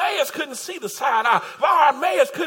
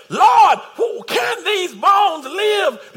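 pleading prophet; 5 semitones higher, 5 dB louder, much slower than usual. Acted. Prophet speaking to God.

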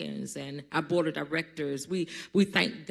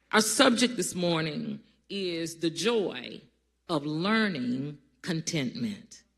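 usual speaking voice

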